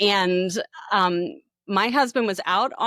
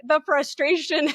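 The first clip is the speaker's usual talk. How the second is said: ordinary delivery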